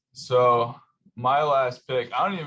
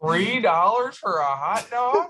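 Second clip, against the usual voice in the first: high, slow, mock-indignant old man; old owner's outrage; 8 semitones higher, slower than usual. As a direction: stretch it out like a stingy old owner who is shocked. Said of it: "he's like".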